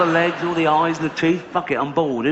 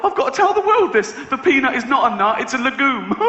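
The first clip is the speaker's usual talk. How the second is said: much higher, breathless urgent announcement; mock urgent revelation; 8 semitones higher, 3 dB louder than usual. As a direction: blurt it like a life-or-death secret. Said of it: "wake up in a cold sweat and go".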